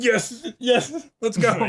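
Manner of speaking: high, eager yes. Retold or imitated. Husband eagerly agreeing to go see his wife, pitched high. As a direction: jump at the offer. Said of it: "it being the thing of the".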